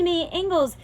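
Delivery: plain reading voice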